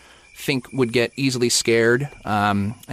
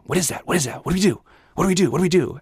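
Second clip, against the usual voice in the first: hushed panic, higher pitched, imitating a friend; quietly worked up; 6 semitones higher, much faster than usual. Friend seeing the orb asks what to do, imitated hushed panic. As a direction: fret under your breath, pitch rising with each question. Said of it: "getting really worked up, uh, in a quiet way".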